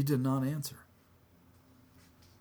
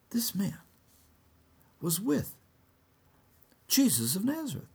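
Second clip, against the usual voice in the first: put-on high girl's voice; servant girl; 10 semitones higher than usual. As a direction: tell the bystanders in a high voice. Second servant girl points Peter out.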